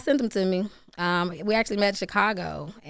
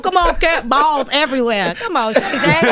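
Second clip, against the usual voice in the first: loud, high, hammed-up calling out; playful raised-voice heckle; mock exhortation; 5 semitones higher, 8 dB louder than usual. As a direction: holler it like a comic egging them on. Hammed-up teasing call to podcasters.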